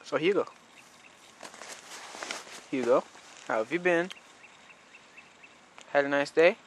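quiet, gentle voice to dog; soft pet talk. Real, not acted. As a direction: murmur tenderly to the dog.

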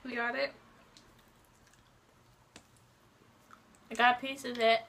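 words muffled by chewing; talking while chewing. Real, not acted. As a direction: mouth partly full of chocolate. Seen 1: Both girls bite and chew chocolate; unclear which one speaks.

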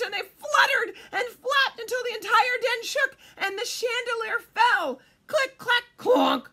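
high, loud, breakneck storybook frenzy; excited climax narration. A narrator's reading. Reader telling birds' fluttering panic, loud excited narration. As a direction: build the commotion at full volume.

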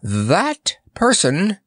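high, slow, emphatic accusation; crying out. A narrator's reading. Chamberlain waving toward Notta, crying out. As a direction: point out the culprit in a drawn-out cry. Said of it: "cried Tazzywaller with a wave".

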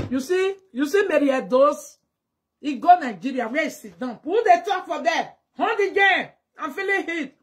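playful mock taunt; teasing. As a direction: rib her loudly. Teasing Mary Edo, raised voice.

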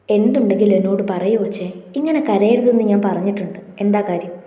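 ordinary delivery

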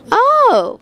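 high, drawn-out oh of discovery; sudden realisation. Retold or imitated. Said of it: "I was like".

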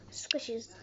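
soft whispered repeat; whispering. Real, not acted. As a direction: breathe the word out.

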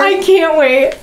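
eager, high-pitched anticipation; bursting to get started; giddy with real excitement; so excited. Real, not acted. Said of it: "I'm so excited".

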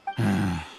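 a low, tired exhale through the nose; weary sigh. Acted. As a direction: sigh as if the goods bore you. Annoyed customer at shady stall.

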